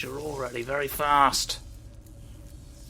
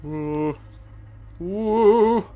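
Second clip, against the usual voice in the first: high, loud delighted ooh; excited ooh; 5 semitones higher, 4 dB louder, much slower than usual. Real, not acted. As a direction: let out a thrilled ooh. Excited exclamation.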